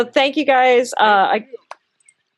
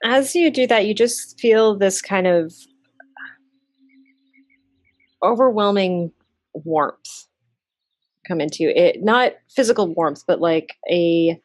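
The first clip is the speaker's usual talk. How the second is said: soothing narration; 4 semitones lower than usual. Acted. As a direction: ease them into a sense of comfort. GM describes comforting warmth.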